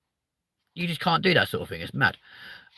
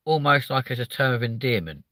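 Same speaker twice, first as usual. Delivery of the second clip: ordinary delivery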